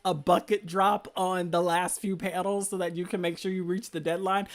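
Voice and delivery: normal talking voice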